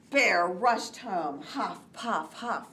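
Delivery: lively, animated read-aloud; reading to children. A narrator's reading. Teacher reading Kite Day to young children, animated. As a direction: read it with big storybook energy. Reads picture book aloud.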